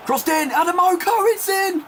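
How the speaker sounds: high, loud shout of celebration; excited goal call. Real, not acted. Commentator calls Adamoko's goal, excited shout. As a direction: build from the cross to a high shout.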